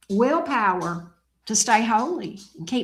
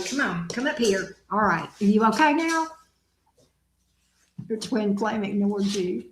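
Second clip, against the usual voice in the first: usual speaking voice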